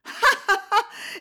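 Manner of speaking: bright high burst of laughter. Acted. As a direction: laugh delightedly at the child. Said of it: "en riant". (rire aigu et amusé)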